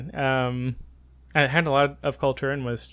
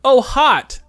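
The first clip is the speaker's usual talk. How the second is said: high, loud acted "aha" moment; sudden realization; 11 semitones higher, 8 dB louder than usual. Tourist finally reads the pharmacist's word, loud realization. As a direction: voice leaps up as it clicks.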